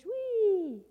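high, loud, drawn-out squeal of glee; gleeful whee. Acted. Storyteller voices boy swinging on vine, gleeful cry. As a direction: swoop through it with delight.

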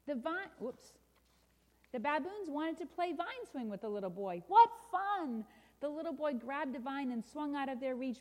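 animated storybook narration; children's story reading. A narrator's reading. Preacher reads a picture book to listeners, animated storytelling. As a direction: read it to children with lively emphasis.

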